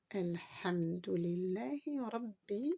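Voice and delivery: Elhamdulillahi Rabbi read slowly; slow demo. Shown as a demonstration. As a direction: read it slowly, linking the words. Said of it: "builds the verse". (lecture lente et liée)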